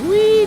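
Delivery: high, loud drawn-out "whee"; very high, loud squeal; playful squeal. Real, not acted. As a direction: squeal like on a fairground ride. Car slides near the wall.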